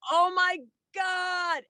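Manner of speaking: high, drawn-out cry of horror, retold; shocked cry. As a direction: re-enact a stunned, stretched-out exclamation. Retold cry as plane hits.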